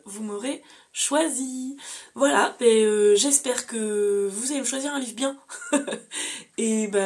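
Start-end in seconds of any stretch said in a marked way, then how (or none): 5.4-6.5 short breathy giggle, way up high; a light shy laugh; high airy laugh. Real, not acted. Hoping viewers pick a good book, she gives a high airy laugh.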